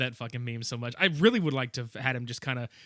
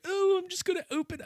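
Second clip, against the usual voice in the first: high mock giddy voice; lightly squeaky mayor; silly mayor voice. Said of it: "he doesn't really talk like this".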